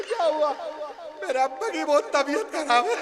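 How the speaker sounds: high, laughing voice on sad words; mock giggler laughing at sad news; laughing through bad news. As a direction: giggle while telling the sickness. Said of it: "एक हंसते आया".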